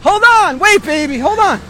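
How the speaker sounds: loud, urgent call to wait. Real, not acted. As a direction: call after someone leaving. Calling to someone to wait.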